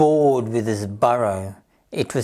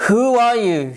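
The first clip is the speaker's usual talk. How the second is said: higher, louder startled duck cry; cross shriek; 7 semitones higher, 5 dB louder than usual. Acted. Duck startled by a rabbit popping up, shrieking crossly. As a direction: snap it out, ruffled and cross. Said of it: "shrieked Dora crossly".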